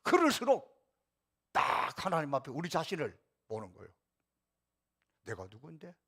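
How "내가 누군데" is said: quiet inward retort; inner voice. Pretend. Pastor's silent reply to a critic, quiet and inward. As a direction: say it under your breath to yourself. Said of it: "속으로".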